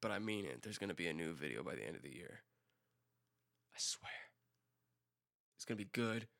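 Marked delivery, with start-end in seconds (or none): 0.6-2.4 low, soft, tentative announcement; quiet promise. Real, not acted. Promising viewers a new video, low and soft. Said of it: "But I said it, and I meant it". 3.8-6.3 whisper. Real, not acted. Swearing promise to viewers.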